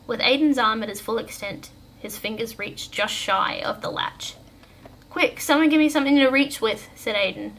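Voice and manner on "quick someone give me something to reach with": high, louder urgent call; pressing request, voice raised; urgent, struggling. A narrator's reading. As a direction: call for help while straining to balance. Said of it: "struggling to maintain balance".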